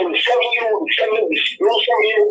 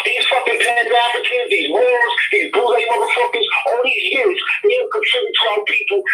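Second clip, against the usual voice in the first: heated rant climbing high in pitch; excited, worked-up; 8 semitones higher than usual. Real, not acted. Caller fed up with people not supporting their own, excited rant. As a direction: let the indignation carry you higher and higher. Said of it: "I get excited".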